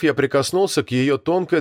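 ordinary delivery, nothing marked

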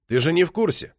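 a touch of father's indignation; starting to raise his voice. A narrator's reading. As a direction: push back with rising irritation. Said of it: "стал повышать голос и Токарев".

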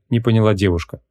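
plain reading voice

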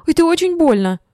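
high, loud cry of pain. A narrator's reading. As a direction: cry out in pain while pushing someone off. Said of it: "закричала я с силой". (крик от боли)

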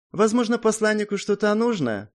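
high-pitched insinuating question; suggestive. A narrator's reading. Young man offering the envoy something, suggestive. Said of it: "с намёком в голосе произнёс парень".

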